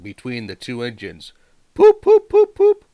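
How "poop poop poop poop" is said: very high, loud engine whistle poops. A narrator's reading. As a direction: hoot like a steam whistle. Engines whistling while pulling.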